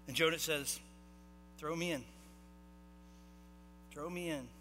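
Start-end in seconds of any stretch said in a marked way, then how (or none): 1.6-4.5 soft, subdued answer with a long pause between; quiet resignation. Acted. Jonah answers the sailors' question, quiet resignation. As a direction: say it quietly, then let it sink further.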